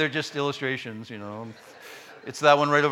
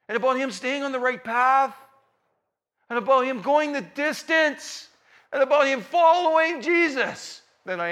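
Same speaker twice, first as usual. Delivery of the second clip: building louder and higher to a preaching peak; rising passion; 9 semitones higher, 8 dB louder than usual. Real, not acted. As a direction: climb with conviction toward the climax.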